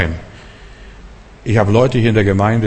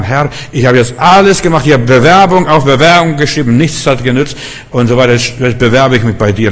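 raised, exasperated plea to God; acted crying out; 2 semitones higher, 5 dB louder than usual. Jobless man crying to the Lord, raised pleading. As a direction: voice lifted, pour out the complaint. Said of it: "Aber er schrie zum Herrn".

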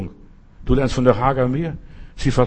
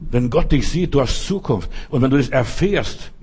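ordinary talk, nothing marked

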